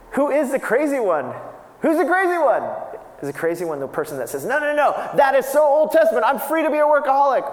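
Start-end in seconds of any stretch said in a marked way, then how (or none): 0.1-1.3 higher and louder preacher challenge; raised rhetorical question. Real, not acted. 1.8-2.6 much higher and louder, building to a cry; near shout. Real, not acted. 4.4-7.5 higher, louder mock protest; mocking shout. Mocking the workaholic's excuse.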